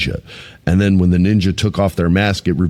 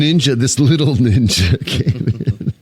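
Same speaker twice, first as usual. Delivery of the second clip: slow, laughing through the words; 3 semitones higher, slower than usual. Real, not acted. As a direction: giggle while saying little ninja. Laughs mid-line.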